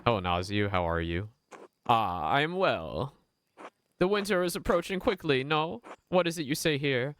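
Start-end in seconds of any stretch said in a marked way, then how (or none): 1.9-7.1 put-on higher, courteous Italian-accented merchant; gentle Italian accent, lifted pitch, unhurried. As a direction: speak as a polite Genoese trader in an Italian accent. Voices Naziu, Genoese merchant.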